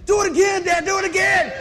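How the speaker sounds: high, loud delighted begging; excited kid. Retold or imitated. Son Thomas begging his dad to fall again, high loud delight. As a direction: beg like a thrilled little boy. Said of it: "Thomas is like".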